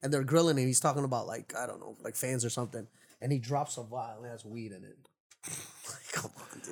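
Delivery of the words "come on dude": breathy laugh through words. Real, not acted. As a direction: laugh it out softly. Laughs at weed vial story.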